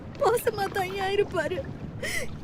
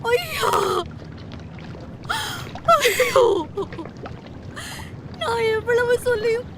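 wailing grief; 4 semitones higher than usual. Acted. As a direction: wail over the dead husband. Widow learns husband died.

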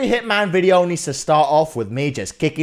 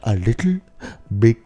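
normal talking voice

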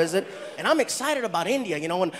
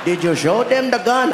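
put-on loud Indian-accented questioning; 5 dB louder than usual. Getaway partner checking on the gun, loud Indian accent. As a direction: press him loudly in an Indian accent.

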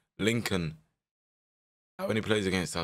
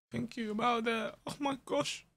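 mock high voice; 13 semitones higher, 6 dB quieter than usual. Mock player thanking him.

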